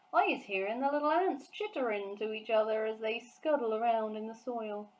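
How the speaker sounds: lightly voiced boastful giant; proud, folksy giant talk; BFG giant voice. A narrator's reading. Giant telling Sophie about his ears, lightly voiced giant.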